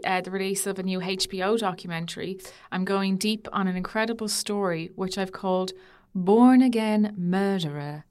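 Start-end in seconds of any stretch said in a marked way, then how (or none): 6.2-8.1 slow, loud, ominous announcement; dramatic title reveal. Acted. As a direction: drop the title like a movie trailer. Announcing her story's title.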